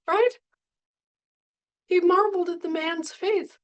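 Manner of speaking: rising astonishment in the telling. Real, not acted. Preacher tells Jesus' amazement.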